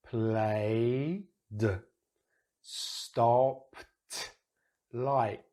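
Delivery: "played", "stopped", "liked" with the final d or t split off; slow, segmented "played, stopped, liked"; sounded-out endings. Shown as a demonstration. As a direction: pause before the last consonant of each word. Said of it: "let's have a look at the I P A".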